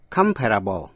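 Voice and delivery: "comparable" stressed on its first syllable. Shown as a demonstration. Teacher contrasting adjective with verb, first-syllable stress on "comparable". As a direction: hit the opening syllable of "comparable". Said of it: "thì dấu nhấn ở vần đầu".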